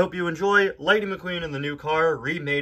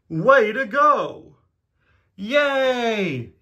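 high, drawn-out cheers; hammed-up cartoon celebration; acted cheering; 8 semitones higher, 4 dB louder, much slower than usual. Friends cheer the race winner.

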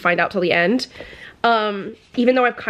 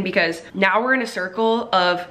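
ordinary delivery

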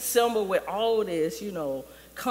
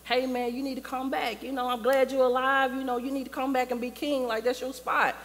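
higher-pitched urging; Nala; 6 semitones higher than usual. Retold or imitated. Nala urges Simba home, higher and insistent. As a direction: plead in a higher voice. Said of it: "Nala comes back on the scene".

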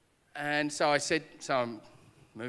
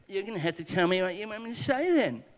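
petulant demand; 5 semitones higher, 4 dB louder than usual. Retold or imitated. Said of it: "it was gonna be that petulant".

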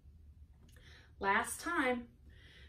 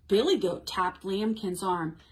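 expressive picture-book narration, louder than chat; animated storytime voice with big swings on key words; reading to children; 8 dB louder than usual. A narrator's reading. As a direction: read a story aloud to small children.

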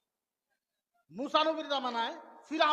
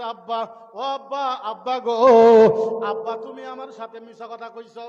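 crying plea; 2 semitones lower, 15 dB louder than usual. Acted. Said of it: "কানতে কানতে আসছে".

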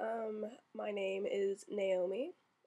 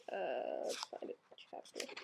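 Chapter murmured very softly, almost voiceless, while searching; muttered to self; 8 semitones lower, 6 dB quieter than usual. Real, not acted. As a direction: mumble Chapter to yourself under your breath.

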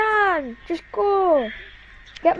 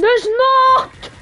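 excited protest; 11 semitones higher, 6 dB louder than usual. Real, not acted. Contradicting teammate sharply.

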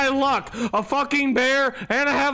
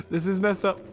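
usual speaking voice

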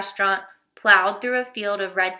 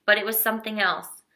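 ordinary delivery, nothing marked

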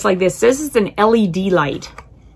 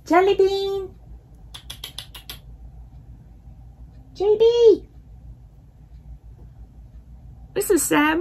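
calling the cat; 10 semitones higher, 5 dB quieter than usual. Real, not acted. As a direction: sing out his name to bring him over. Calling the absent cats.

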